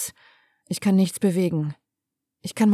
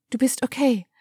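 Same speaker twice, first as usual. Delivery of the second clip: as if in a trance; 4 semitones higher than usual. A narrator's reading. Daughter in shock murmurs to her father, higher and trance-like. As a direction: chant it to herself, dazed. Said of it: "wiederhole ich".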